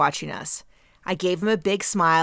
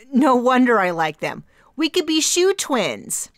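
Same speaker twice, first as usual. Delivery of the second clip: awkward excitement; 7 semitones higher, 7 dB louder than usual. Acted. Awkward laugh, covering embarrassment.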